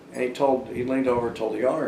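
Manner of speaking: ordinary delivery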